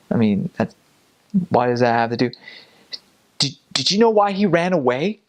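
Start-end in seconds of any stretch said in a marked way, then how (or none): 3.4-5.2 higher-pitched, pointed questioning; accusing wife. Retold or imitated. Wife interrogating the missionary about his companion, accusing. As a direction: question him as a suspicious wife.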